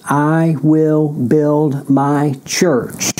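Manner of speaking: each word laid down slowly, WILL stressed; solemn, measured proclamation; slow emphatic quote. Acted. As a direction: spell out "I will build my church" word by word. Preacher quotes Jesus, word by word.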